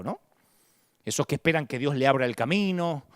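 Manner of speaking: normal talking voice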